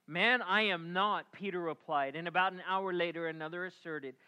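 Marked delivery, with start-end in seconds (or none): 0.1-1.3 denial. Acted. Peter denies him by the fire.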